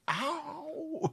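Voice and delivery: drawn-out high cry of pretend pain; mock pained "ow". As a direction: yelp as if just hit. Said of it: "imagine them just getting hit".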